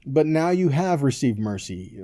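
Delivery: ordinary talk, nothing marked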